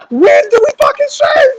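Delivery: high, loud yell; mock furious sergeant. Sergeant repeating his question to a clueless recruit, furious mock shout. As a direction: scream it like an exasperated drill sergeant.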